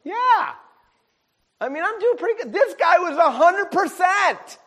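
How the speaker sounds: high, loud, emphatic declaration; building preacher's exclamation; preaching shout. Real, not acted. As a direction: drive the point home. Preaching climax.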